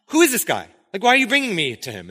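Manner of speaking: high, loud, exasperated questioning; agitated Pilate. Acted. Pilate demanding answers from the religious leaders, agitated. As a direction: play an official put out by the intrusion.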